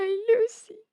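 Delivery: high tearful wail over the doll; a girl mourns her broken doll; crying. A narrator's reading. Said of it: "Emi llora".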